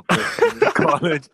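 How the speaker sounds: breaking into a laugh mid-line; nervous giggle through it. Real, not acted. Nervous self-introduction.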